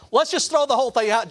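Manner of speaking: high, loud mock-confident appeal; cocky elation. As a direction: pitch it up, sure you have won. Emboldened defendant urges the judge.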